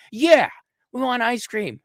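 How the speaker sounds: put-on high loud kid shout; eager kids. Kids begging grandpa for ice cream, high and loud. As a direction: yell it like excited children.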